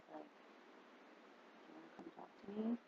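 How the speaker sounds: softly and slowly, very quiet; gentle hushed offer. Retold or imitated. Sheriff quietly inviting the upset narrator to talk, hushed.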